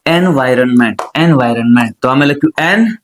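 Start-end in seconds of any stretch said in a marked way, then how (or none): 0.1-2.0 એન્વાયરમેન્ટ as "en-viron-ment", pitched low; broken into chunks. Shown as a demonstration. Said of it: "ટુકડા ટુકડા પાડીને". 2.6-3.0 એન as a separate piece; sounded out. Shown as a demonstration. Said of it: "ટુકડા ટુકડા પાડીને".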